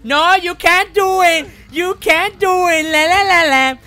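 high, loud mocking chant; teasing, high-pitched jeer; gleeful taunting. Real, not acted. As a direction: gloat at the top of your voice. Gloating taunt at the opponent.

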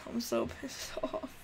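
whispered, fast, fed up; defeated whisper. Real, not acted. Streamer whispering that he's done with Fortnite, defeated whisper. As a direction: breathe it out like you're done. Gamer giving up.

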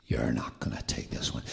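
low, quick taunt; mocking. Mocking voice to the ailing preacher, taunting. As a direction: drop low and taunt briskly. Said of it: "and a mocking voice comes".

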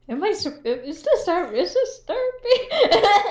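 laughing disbelief, voice jumps high. Real, not acted. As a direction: giggle through the question. Surprised at therapy question, laughing.

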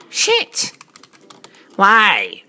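ordinary delivery

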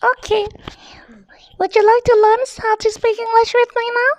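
very high squeaky cartoon voice; silly high whispery voice; funny put-on voice. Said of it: "Are you okay with this voice?".

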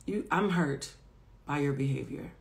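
soft, hurt plea to a friend; vulnerable plea. Retold or imitated. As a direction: say it quietly, wounded and sincere. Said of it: "be vulnerable and brave enough to say".

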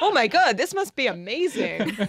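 hammed-up, naive excitement; mock amazement. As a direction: gush like a gullible horror fan. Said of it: "you're like".

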